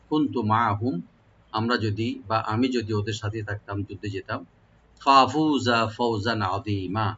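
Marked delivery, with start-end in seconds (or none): 5.0-7.1 ফাফুজা ফাওজান আযীমা drawn out very slowly; slow recitation. Shown as a demonstration. Teacher modeling the verse slowly for students. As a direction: stretch each syllable in slow recitation. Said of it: "teacher recites verse".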